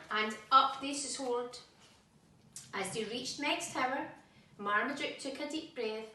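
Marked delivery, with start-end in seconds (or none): none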